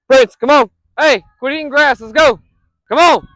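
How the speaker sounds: firm shout to get him moving; calling the dog. Real, not acted. Owner calling dog off the grass with loud firm commands.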